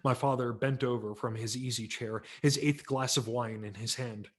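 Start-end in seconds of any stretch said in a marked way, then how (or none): none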